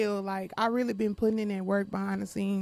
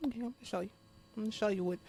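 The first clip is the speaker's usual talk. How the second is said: much softer, under the breath; a quiet promise to get even; muttered threat; 9 dB quieter, much faster than usual. Real, not acted.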